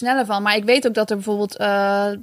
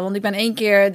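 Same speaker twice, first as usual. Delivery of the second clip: usual speaking voice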